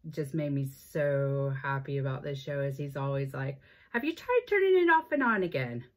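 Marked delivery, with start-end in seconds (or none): 3.9-5.8 high, loud, hammed-up bad Irish accent; badly faked Irish, high and loud. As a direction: imitate a TV catchphrase in a badly faked Irish lilt, pitched way up. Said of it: "gonna give you a bad Irish accent".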